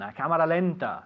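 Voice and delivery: ordinary talk, nothing marked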